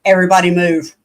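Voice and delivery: retold dad's warning. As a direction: call it out like a dad clearing the area. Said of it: "my dad literally threw, said".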